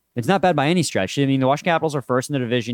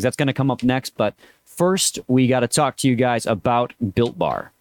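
usual speaking voice

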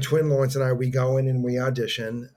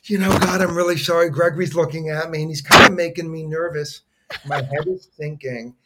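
louder, higher flustered apologizing; mock nervous apology; 3 semitones higher, 7 dB louder than usual. Twin apologizing to the casting director, louder and flustered. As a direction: gush out a nervous sorry. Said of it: "he goes".

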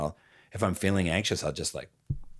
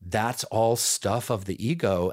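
usual speaking voice

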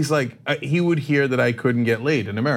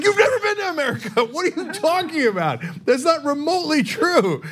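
much higher-pitched, incredulous protest; exasperated disbelief. Retold or imitated. Retelling his rebuttal to his cousin from Turkey, exasperated. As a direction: squeal the objection in hammed-up outrage.

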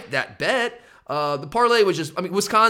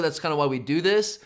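usual speaking voice

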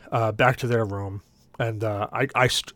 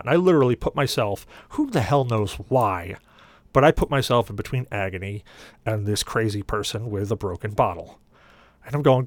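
usual speaking voice

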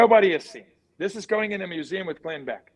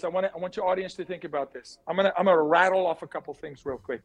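fast, rattling delivery; rapid fire; 2 semitones lower, faster than usual. Real, not acted. To the host's audience, a rapid brain dump, fast. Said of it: "This is rapid fire".

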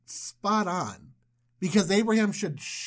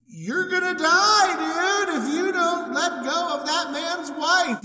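high, drawn-out spectral wail; ghost voice; 7 semitones higher than usual. Acted. God warns Abimelech in a dream, imposing ghost voice. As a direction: intone it slowly like a haunting spirit. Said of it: "Like a ghost voice".

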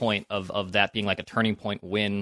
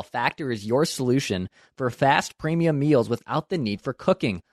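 upbeat host-read ad, higher and louder; cheerful sponsor read, pitched up and louder; 5 semitones higher, 5 dB louder than usual. Acted. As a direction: sell the meal kit like a bright radio spot. Reads a sponsor ad.

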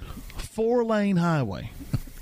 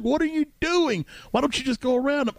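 high-pitched retold exasperation; exasperated scolding; 9 semitones higher than usual. Passenger scolding driver who stopped dead, high exasperated protest. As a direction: pitch jumps up in mock disbelief.

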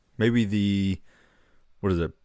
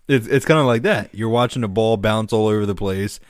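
ordinary delivery

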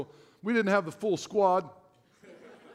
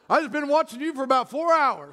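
put-on Alabama drunk; 3 semitones higher than usual. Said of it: "He's from Alabama".

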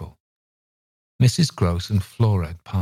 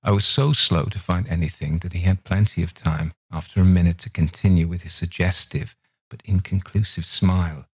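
ordinary delivery, nothing marked

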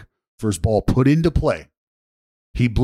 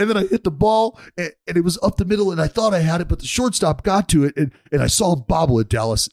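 high-pitched, earnest retelling; mock-earnest, higher-pitched play recap; imitated heartfelt storytelling; 7 semitones higher, faster than usual. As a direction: imitate a friend seriously recounting his big play. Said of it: "very heartfelt, like, he's serious".

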